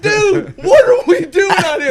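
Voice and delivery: high loud laughing shout as the monkey. Retold or imitated. Voicing the chained monkey to friends, high laughing shout. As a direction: yell it as the fed-up monkey, cracking up. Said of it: "He's like".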